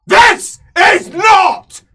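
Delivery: slow, roaring imperial rebuke; furious shout. Acted. Emperor shouting down the brawling player, furious roar. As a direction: bellow it out, dragging each word.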